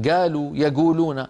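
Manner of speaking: "قالوا، يقولون" with hard g for q; qaf as gaf. Shown as a demonstration. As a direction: say "قالوا، يقولون" as galu, yaguluna. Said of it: "صوت القاف".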